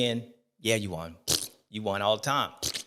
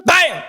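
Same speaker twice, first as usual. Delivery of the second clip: loud, high imitated impact; retold crash noise cutting off the quote; crash bam; 10 dB louder than usual. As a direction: hit the bam hard.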